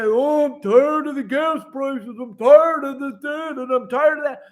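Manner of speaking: captain mocking people who only complain; mocking complainers. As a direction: whine like a lazy complainer. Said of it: "I hear you all say".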